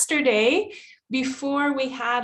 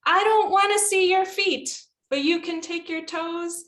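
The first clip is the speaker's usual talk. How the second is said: high, level, rhythmic instructions; coaching a stretch; 5 semitones higher than usual. Retold or imitated. Teacher leading students to curl toes, high rhythmic coaching. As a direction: lead the toe curl in a raised chant.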